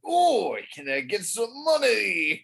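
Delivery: hammed-up cockney beggar; British oi voice. Shown as a demonstration. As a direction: demo a cheeky cockney ask. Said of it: "your oi voice".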